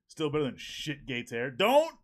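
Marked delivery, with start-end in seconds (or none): 1.5-2.0 sharp shout. Real, not acted. Chat member brings up the hair.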